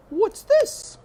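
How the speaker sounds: high, loud, surprised cook. A narrator's reading. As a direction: call out at the sight of the ears. Cook spots something.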